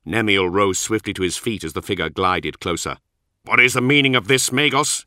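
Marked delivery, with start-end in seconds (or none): 3.4-5.1 loud, high angry challenge; snarled. Acted. Nemiel confronting the Magos, a loud angry snarl. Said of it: "he snarled".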